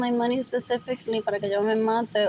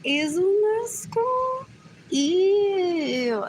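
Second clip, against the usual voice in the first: disgusted; 9 semitones higher, much slower than usual. Acted. Disgusted outburst.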